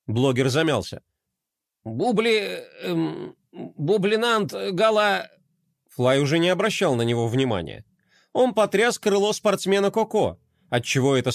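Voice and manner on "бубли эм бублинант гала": hesitant, slow self-introduction; stammering blogger. Acted. Embarrassed blogger fumbling his name, halting. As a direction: stumble over your own name.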